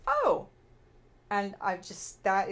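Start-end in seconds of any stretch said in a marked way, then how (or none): 0.1-0.5 much higher, loud sudden oh; surprised oh. Retold or imitated. John realizing who she is, a sudden surprised oh. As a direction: caught off guard as it clicks. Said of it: "and he goes".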